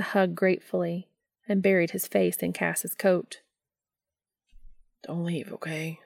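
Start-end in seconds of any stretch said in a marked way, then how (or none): 5.0-6.0 soft, muted plea; understated, subdued begging; pleading. A narrator's reading. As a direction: plead quietly into someone's coat. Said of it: "Dean pleaded into the heavy fabric".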